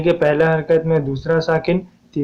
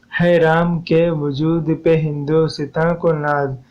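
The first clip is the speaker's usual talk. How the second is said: slow, syllable-by-syllable verse recitation; measured scansion; slower than usual. Shown as a demonstration. Teacher showing students the verse's metre, slow measured scansion. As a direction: draw out each metrical unit evenly.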